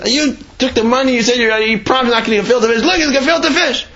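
high, indignant scolding; outraged priest. Acted. Priest confronting the convert over the fish, high and indignant. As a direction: wag a finger, voice high.

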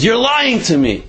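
high, pushy mugger demand; snatch at the vial with words; mock gruff demand. Mugger demanding the woman hand over the vial.

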